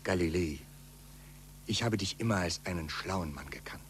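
hushed, earnest warning; quiet reproach. Acted.

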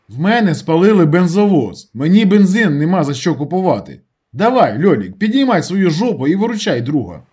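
high, loud, exasperated appeal; insistent urging. A narrator's reading. Narrator urging Lyolik to help after the arson, insistent. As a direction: pressure your friend to act.

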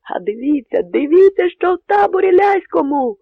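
building to a high, loud cry; sentry shouting to look at the Polish camp. Acted. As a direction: point and yell at the enemy camp. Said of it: "закричав чатовий".

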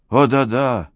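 rising bitter exclamation; bitter cry. A narrator's reading. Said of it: "вскричал молодой человек с горькой улыбкой".

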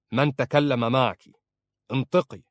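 a husband leaning in with a hard demand. Acted.

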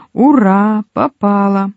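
high, jubilant cry; triumphant cheer. A narrator's reading. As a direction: cheer a lucky hit. Acted triumph in drinking game.

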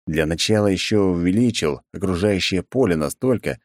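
usual speaking voice

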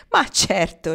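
loud, hearty amused reply; amused, laughing. A narrator's reading. Pocket answering Marnie through his mirth, laughing heartily. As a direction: burst out with it, highly amused. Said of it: "scoppiò ancora a ridere".

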